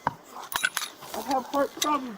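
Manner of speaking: breathless, high pleading; gasping for air between words; hyperventilating. Real, not acted. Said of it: "I can't breathe".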